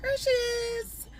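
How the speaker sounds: showing off. Acted. Reveals her own face.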